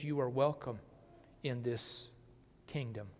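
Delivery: the kingdom gently tells the lowest they are welcome; gentle welcome. Acted. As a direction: tenderly welcome the least and the lowest.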